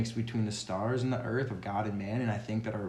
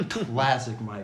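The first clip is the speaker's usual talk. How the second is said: laughing; 8 semitones higher, 9 dB louder, slower than usual. Real, not acted. Laughing at his own rambling.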